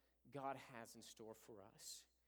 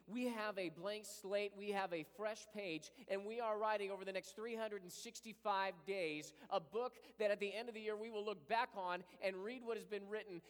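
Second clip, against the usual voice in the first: loud, high, fervent preaching; full-force preaching; 6 semitones higher, 5 dB louder than usual. Acted. Pastor crying out to God before the congregation, loud and fervent. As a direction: preach it out with fire.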